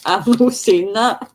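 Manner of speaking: imitated refusal. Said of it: "Joe's just looking at me and going".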